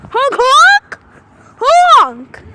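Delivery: loud, high calls of honk to passing cars; shouted honk. Real, not acted. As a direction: yell it at the drivers. Kids yelling at cars to honk.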